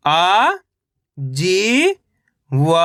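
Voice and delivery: each syllable held long, separated by pauses. Shown as a demonstration. Teacher sounding out a word for reading learners, syllable by syllable. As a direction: sound out each letter slowly, stretching it. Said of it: "एक एक अक्षर का उच्चारण".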